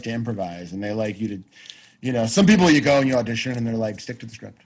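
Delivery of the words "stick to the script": very soft, low imitation of a stern order; muttered mock order. Imitating casting people at an audition, muttered order. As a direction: drop to a low, barely voiced aside.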